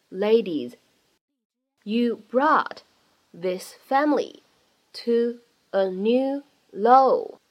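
slow reading with a pause after each chunk; word by word. Shown as a demonstration. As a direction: break it up word by word for learners. Word-by-word practice reading of the lines.

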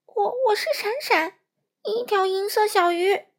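high, loud, stammering with fright; startled little fish. Acted. Startled fish answers giant.

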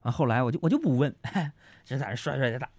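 usual speaking voice

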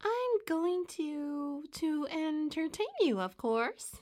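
high, drawn-out squeaky show voice; Mary Moo Cow. A narrator's reading. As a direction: squeakily stretch it like a cow entertainer. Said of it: "in a squeaky voice".

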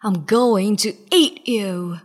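low, slow genie growl; deep slow monster growl. A narrator's reading. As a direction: rumble it like a starving giant. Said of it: "he growled".

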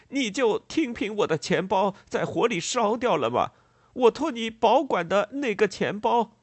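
loud high-pitched outraged cry; enraged. A narrator's reading. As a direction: yell in outrage at the betrayal. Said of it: "激怒了的布瑞克南". (激怒地高声叫喊)

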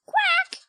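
high, bright quack; duck voice. Acted. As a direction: quack like a little duckling.

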